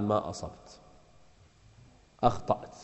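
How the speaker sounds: very soft, low acted correction; quiet rebuke. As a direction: drop almost to nothing, with pauses between words. Caliph quietly rebukes the companion.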